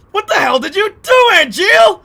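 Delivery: very high, booming outraged yell; imitated actor's shout. Streamer imitating Rick Gomez's line for chat, loud high shout. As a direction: shout it full force, pitch way up. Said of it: "That's how he says it".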